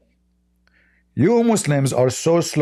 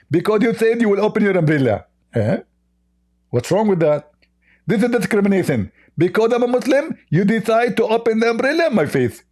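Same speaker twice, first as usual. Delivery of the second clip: high, squeaky mock voice; a hammed-up high caricature voice; mocking Zakir Naik; 7 semitones higher than usual. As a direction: lampoon the opponent in a silly high pitch.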